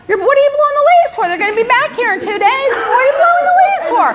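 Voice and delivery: high-pitched, rapid mock outrage; retold exasperation. Boss retelling her scolding of the man blowing leaves before a hurricane, exasperated. Exasperated retort to yard worker.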